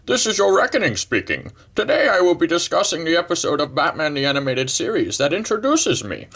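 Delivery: menacing villain greeting to the city, in a put-on voice; Bane voice. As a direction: play the villain Bane announcing himself to Gotham. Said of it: "Okay, that's enough of that".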